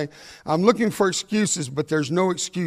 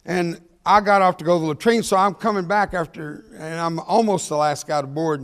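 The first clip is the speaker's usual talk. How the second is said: ordinary talk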